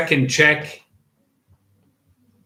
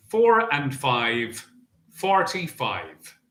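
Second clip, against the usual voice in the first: bingo caller. Acted. Bingo number calling.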